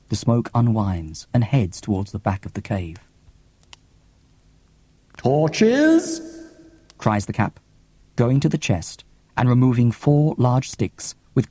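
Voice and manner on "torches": surprised cry. Acted. The cap cries out.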